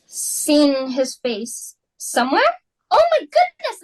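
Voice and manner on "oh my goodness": high, loud cry of dismay; sudden shock at the news; oh my goodness, high and loud with alarm; shocked gasp. Acted. Hostess realises guest is the prince.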